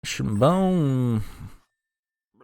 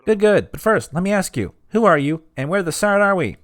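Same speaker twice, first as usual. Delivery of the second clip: a lively game character asking questions; high character voice; 6 semitones higher than usual. Acted. Voicing character Jerry.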